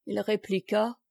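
plain reading voice

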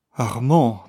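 a touch of breathlessness on the name; half-gasp his name as you take his arm; breathless. A narrator's reading. Wife staggering up to her husband, a mild breathless call. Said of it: "d'une voix haletante".

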